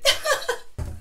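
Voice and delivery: short, high burst of laughter; real, spontaneous giggle after dropping things. Real, not acted. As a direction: laugh at your own clumsiness. Drops her products on camera.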